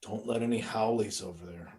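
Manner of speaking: normal talking voice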